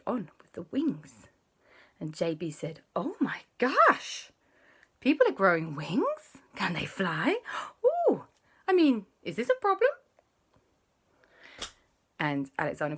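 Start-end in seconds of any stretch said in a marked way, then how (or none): 2.9-9.9 high, astonished gasp of a voice; amazed J B. Acted. J B hearing that people grow wings, high and astonished. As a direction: react in wide-eyed amazement.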